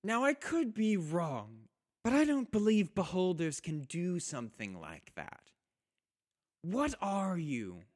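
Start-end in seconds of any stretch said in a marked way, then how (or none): 2.2-7.8 drow leader. Acted. Drow leader challenges the beholder.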